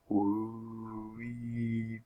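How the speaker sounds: slow rising and falling siren whoop; fire siren wail. A narrator's reading. Narrator imitating a fire siren down the street. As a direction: stretch it out like a far-off siren. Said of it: "a noise like a fire siren".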